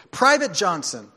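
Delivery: captain shouting his order at the private. Acted. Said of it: "the captain shouted back".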